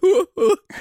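sudden high squealing laugh; a loud laugh that escapes her; burst of laughter. Real, not acted. Guest laughs out loud at her own odd sound. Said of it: "Jag vet inte varför jag lät".

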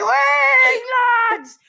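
hammed-up victory shout to the lads; Geordie cheer. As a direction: bellow it like a hooligan celebrating.

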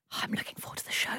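hushed quick whisper. Pretend. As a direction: breathe it like a secret. Whispered echo of co-host.

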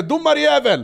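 högt och ljust hånrop; mock shout at ref. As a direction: skrik ut det mot domaren. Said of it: "jag kan säga". (gapa högt och ljust åt domaren)

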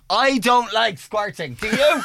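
high, loud, slow mock shout; loud, high retold shouting with a laugh; imitating a shout. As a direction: belt it out like across a smoking area. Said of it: "Shouting at the top of her voice".

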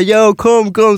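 imitated urgent call, high-pitched. Calling Oscar and Katja over, eager mock shout. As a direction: call friends over from further back. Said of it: "ropade jag på dem".

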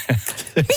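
laughs out high mid-story; can't hold back the laugh; burst of laughter. Real, not acted. Laughing while telling hotel story. (nauraa ääneen kesken tarinan)

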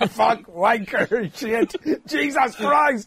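lightly voiced bearded guy swearing to himself; hammed-up muttered swearing, stretched slow; imitating a cursing man. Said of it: "around the side of the hall going".

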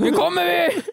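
högt, ljust triumferande skrik; stridsrop. Pretend. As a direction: ropa som en kung på väg att erövra. Kunglig stridsrop, Vasaloppet. (kungen ropar segervisst till sina män)

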